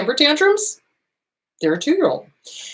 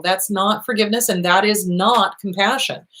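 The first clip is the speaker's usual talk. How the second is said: normal talking voice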